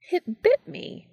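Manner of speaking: high startled yelp; exclaimed. Acted. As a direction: yelp it out, shocked. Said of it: "he exclaimed".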